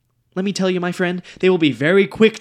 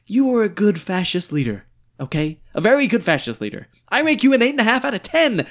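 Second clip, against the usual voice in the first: ordinary delivery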